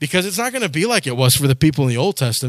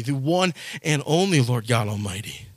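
ordinary talk, nothing marked

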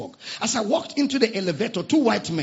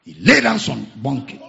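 ordinary talk

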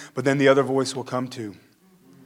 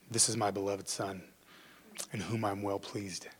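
much softer, lower, almost whispered blessing; hushed announcement; 5 semitones lower, 16 dB quieter than usual. Shown as a demonstration. God announcing his beloved son, soft and reverent. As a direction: let it fall to a reverent hush. Repeats God's words hushed.

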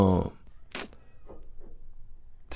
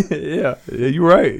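normal talking voice

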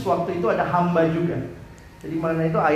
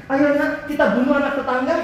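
put-on higher, chirpy mother's voice, inviting a child along; sweetly mock a cheerful mom proposing something awful; playful parody of a chirpy mother; cheery mock mother; 6 semitones higher, 3 dB louder than usual.

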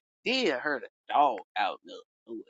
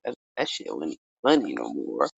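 ordinary delivery

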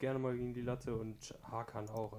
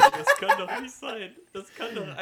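outraged disbelief; 11 semitones higher, 18 dB louder than usual. Real, not acted. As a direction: wail it in disbelief. Losing by a few fouls.

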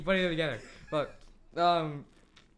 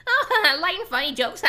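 mocking; 9 semitones higher, 4 dB louder than usual. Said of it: "smooth it all over with this".

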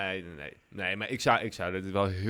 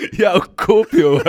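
laughing; 11 dB louder than usual. Real, not acted. Laughing at partner's reaction.

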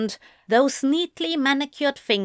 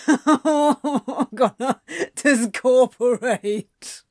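slow words through helpless laughter; dramatic, breathless laughing moan; wheezing laughter; 3 semitones higher, much slower than usual. A narrator's reading. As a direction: laugh as if it will kill you.

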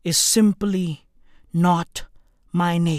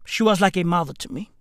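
fast, lower, hurried fake tribute; rushed mock eulogy; 4 semitones lower, 3 dB quieter, much faster than usual. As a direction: rattle it off fast.

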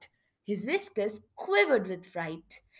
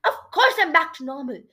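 fast, high, louder retort; hurried, raised-pitch narrated squeak; 5 semitones higher, 6 dB louder, faster than usual. A narrator's reading. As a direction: squeak it out quickly. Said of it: "Geronimo squeaked".

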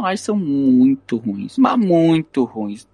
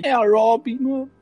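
excited; 9 semitones higher than usual. Retold or imitated. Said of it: "Eu falei".

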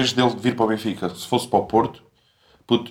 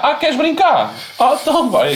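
high, louder mock tyrant taunt; mocking dictator; 14 semitones higher, 3 dB louder than usual. Voicing a dictator threatening war. (ditador a gozar, voz aguda)

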